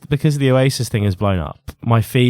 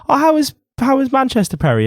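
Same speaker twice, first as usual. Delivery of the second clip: high-pitched mock mum; mum's voice; 12 semitones higher than usual. Mum greeting her son's friend, high mum voice. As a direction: raise the pitch as a chatty mum. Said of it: "Kev's mum comes in, and she goes".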